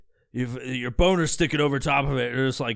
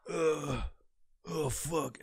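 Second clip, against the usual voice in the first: soft, weary mock groaning; 9 dB quieter, much slower than usual. Man settling into his routine, soft mock groan. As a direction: groan it under your breath.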